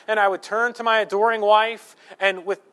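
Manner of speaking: usual speaking voice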